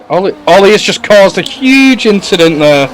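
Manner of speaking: high, loud excited call. Real, not acted. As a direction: blurt it out, stunned by the crash. Commentator reacts to a crash.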